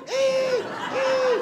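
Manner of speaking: very high squeal; dying seaweed scream. Pretend. Cri de l'algue qui meurt. (cri suraigu de l'algue qui meurt)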